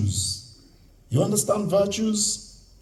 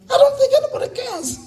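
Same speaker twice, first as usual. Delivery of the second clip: high mock voice; faster than usual. Mocking European indifference.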